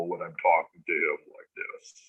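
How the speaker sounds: imitating an uncontrollably deepened voice; voice dropped low. Telling how the channelled being took over, voice dropped low. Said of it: "my voice started to drop really low".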